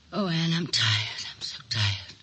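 slow, voiceless, exhausted whisper; weary breathy whisper; tired whisper. Acted. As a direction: let it sigh out, spent. Exhausted confession to Anne.

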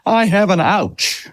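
a hint of a toddler showing his ouch; gentle mock little-kid voice; hurt little boy. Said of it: "He says".

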